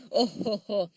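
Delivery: loud, high belly laugh; hearty hooting laughter; roaring laugh. A narrator's reading. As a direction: finish in big ho-ho guffaws. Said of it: "roared Big Ears".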